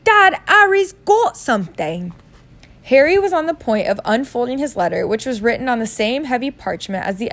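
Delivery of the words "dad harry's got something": high, loud, slow tattling son; Dudley. Acted. Son tells on his cousin to dad, high and loud. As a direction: tell on him in a high, dragged-out voice. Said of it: "said Dudley suddenly".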